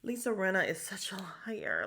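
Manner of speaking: conspiratorial whisper; hushed gossip whisper; whispered aside. Real, not acted. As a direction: lean in and whisper it to the viewers.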